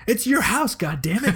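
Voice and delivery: loud, high playful exclamation; mock outrage. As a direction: blurt it out in fake exasperation.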